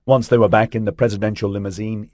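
ordinary delivery, nothing marked

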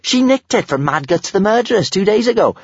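put-on high, matter-of-fact girl; a girl explaining a theft; a hint of a cheeky girl. A narrator's reading. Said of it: "explained Kamikaze".

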